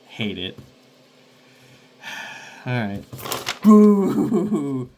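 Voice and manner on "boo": loud, higher jeering boo; mock boo. Real, not acted. Streamer booing his sassy chat, loud mock boo. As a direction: boo them playfully.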